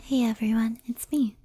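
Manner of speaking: usual speaking voice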